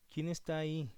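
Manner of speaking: usual speaking voice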